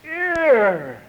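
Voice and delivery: put-on high, drawn-out toddler announcement; little toddler. Farquhar in the kitchen showing parents, high call. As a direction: call out a new word like a proud toddler. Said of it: "he goes".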